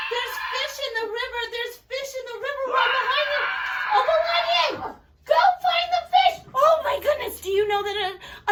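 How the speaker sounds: very high, squealing astonishment; play-acted thrilled panic; hammed-up excitement. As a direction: shriek and gasp as a pretend eagle swoops in. Pretend eagle swoops in.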